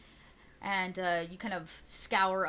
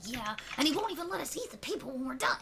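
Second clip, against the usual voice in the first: higher sneering goblin gossip; a goblin complaining about the boss, pitched up; 6 semitones higher than usual. Acted. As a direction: grumble like a scheming goblin at the fire. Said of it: "Another one goes".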